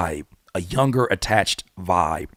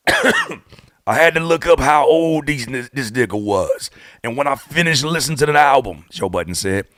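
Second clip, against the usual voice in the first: [name] impression; 2 semitones higher, 3 dB louder than usual. Podcaster scolding [name] about his age, slow impression. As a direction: do your best [name]. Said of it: "to my best [name]".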